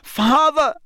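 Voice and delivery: put-on a terrified boy begging; pleading son. A narrator's reading. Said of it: "said the boy".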